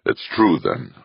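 ordinary delivery

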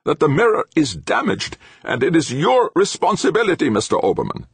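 high, loud angry professor; deadly anger. A narrator's reading. Professor holding his engineer responsible, deadly angry. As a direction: lay down the blame in deadly anger. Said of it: "his voice quivering slightly with deadly anger".

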